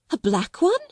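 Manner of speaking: high, bewildered question; stammering. A narrator's reading. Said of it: "Miss Amelia stammered again".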